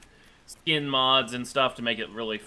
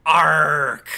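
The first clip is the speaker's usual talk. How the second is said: pirate voice; 10 dB louder than usual. Acted. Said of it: "the pirate voice really hurts your throat".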